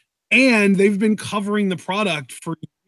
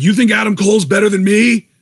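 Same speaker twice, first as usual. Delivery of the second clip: higher, louder boastful Austin Aries jab; mock indignant wrestler; 5 semitones higher, 6 dB louder than usual. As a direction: act out the smug challenge to management. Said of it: "he's like".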